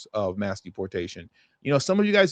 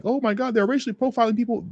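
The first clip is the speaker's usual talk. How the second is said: higher, fast mocking whine; mock outrage; 11 semitones higher, faster than usual. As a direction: parody the critics' panic. Mocking critics of ICE.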